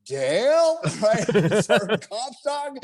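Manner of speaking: finding his teammate in the dark, overjoyed call. Retold or imitated. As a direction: shout with relief at finding a friend.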